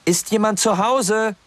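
high, loud call into an empty house. A narrator's reading. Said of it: "Und etwas lauter".

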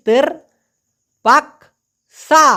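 "তর পাকসা" with "পাকসা" stretched long, high and loud; ধীরে টেনে উচ্চারণ; slow drawn-out demo. Shown as a demonstration. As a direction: draw out "পাকসা" slowly for the learner. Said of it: "উচ্চারণ করেন তো please".

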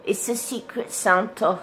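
high, odd put-on accent on Secret Santa; weird accent. Real, not acted. Presenting the gift to viewers, odd accent. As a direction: slip into a silly accent. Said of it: "talking with the weird accent".

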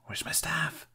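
urgent unvoiced whisper; hissed whisper. A narrator's reading. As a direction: hiss it under your breath. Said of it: "he hissed".

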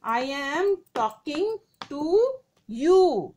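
slow, leaning on am; teacher demonstrating, drawn out; slow example read. Shown as a demonstration. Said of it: "मैं किससे बात कर रही हूँ?".

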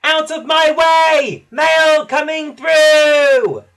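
little engine bellowing like the big express; copying Gordon's slow booming yell; shout as loud as he can. A narrator's reading.

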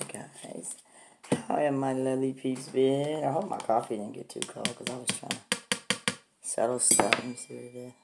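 ordinary talk

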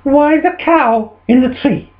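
high-pitched baffled questioning; exasperated farmer's wife. Acted. Wife asking about cow in tree, high exasperation. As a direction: demand an explanation, pitched high.

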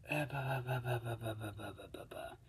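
ba ba ba fading from soft to a whisper; fading babble. Pretend. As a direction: let the syllables die away to nothing. Filler syllables fading to whisper.

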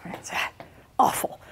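whispered mock despair, almost crying; whimpering robot. The streamlined robot failing to twirl, whispered whimper.

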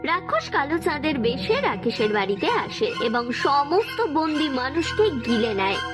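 plain reading voice

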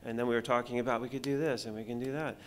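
ordinary delivery

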